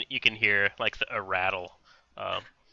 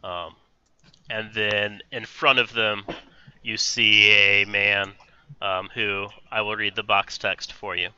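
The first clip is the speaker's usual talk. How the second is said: ordinary talk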